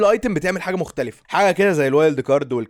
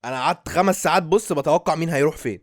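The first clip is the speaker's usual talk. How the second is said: ordinary talk, nothing marked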